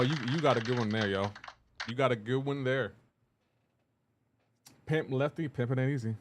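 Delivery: usual speaking voice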